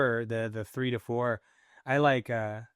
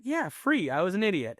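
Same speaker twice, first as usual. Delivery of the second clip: higher-pitched dawning epiphany; mock sudden realization; 8 semitones higher than usual. Man realizing afterwards he was an idiot, mock amazed. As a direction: play the lightbulb moment, pitched up.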